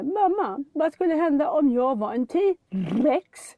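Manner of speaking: mother mimicking her son's slow, curious question; child voice. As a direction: play her small son wondering aloud. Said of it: "så säger Pelle".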